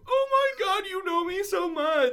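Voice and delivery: very high, loud falsetto squeal; mock gushing. Teasing friend over his order, squealed mock delight.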